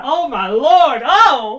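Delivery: loud, high-pitched gushing cry; squealing fan excitement; so excited. Real, not acted. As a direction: burst out with overwhelmed excitement. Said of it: "I'm so excited for that".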